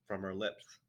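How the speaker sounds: usual speaking voice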